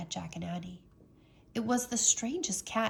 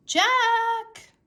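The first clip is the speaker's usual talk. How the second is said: high, loud shout of a name; sharp calling shout; 13 semitones higher, 9 dB louder than usual. A narrator's reading. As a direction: yell his name across the woods. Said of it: "called Annie".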